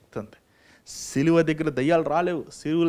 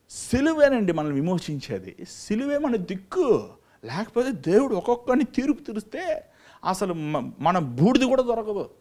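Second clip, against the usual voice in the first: fervent preaching; 7 semitones higher, 5 dB louder than usual. Acted. Preacher proclaims the cross's power to his flock, fervent. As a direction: preach each line like a drumbeat.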